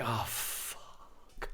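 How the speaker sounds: whispered "Oh, fuck"; mock-panicked whisper. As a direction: hiss it in sudden panic. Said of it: "I was like".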